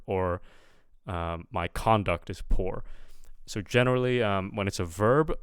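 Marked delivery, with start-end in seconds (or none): 1.5-2.8 "conduct" stressed CON-duct; first-syllable stress. Shown as a demonstration. Teacher giving a noun example, conduct stressed up front. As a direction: put the weight on CON in "conduct". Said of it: "stress will be on the first syllable".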